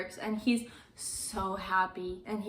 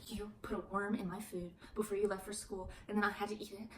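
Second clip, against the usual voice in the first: hushed, voiceless whispered accusation; 10 dB quieter, faster than usual. Retold or imitated. Stepmother accusing Lisa, in a hushed whisper. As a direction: whisper it like a creepy threat.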